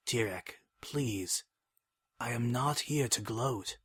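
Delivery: soft, quiet plea; gently begging, hushed; pleading softly. Acted.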